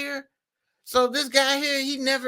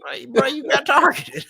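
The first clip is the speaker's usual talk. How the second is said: higher, louder, through real laughter; real laughing; 4 semitones higher, 4 dB louder than usual. Real, not acted. Host mocking the excuse that he got targeted, laughing. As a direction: crack up while saying it.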